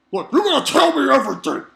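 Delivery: Cohen demanding answers from Aladdin, loud, high and rushed. Retold or imitated. Cohen grabbing Aladdin and demanding everything, loud mock bullying.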